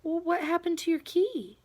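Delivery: put-on high, small child's voice, upset; tearful little boy. A narrator's reading. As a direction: voice a weepy young boy. Child crying over lost key.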